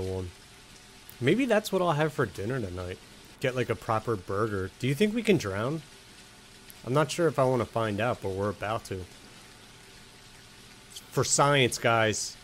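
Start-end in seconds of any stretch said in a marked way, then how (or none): none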